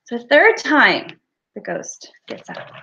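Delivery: normal talking voice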